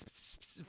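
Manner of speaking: fizzing sound. Retold or imitated. Sparks in circuitry.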